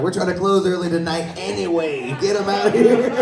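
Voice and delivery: high laughing staff voice; half-laughing mock staff plea; staff quote. Staff joking they want to close early, high laughing voice. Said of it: "They're like".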